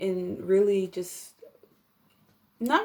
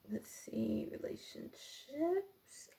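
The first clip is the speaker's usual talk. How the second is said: whispering to herself, drawn out; whispered aside; 7 semitones higher, 12 dB quieter, much slower than usual. Real, not acted. Tarot reader whispering to herself over the spread. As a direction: breathe the words while checking the cards.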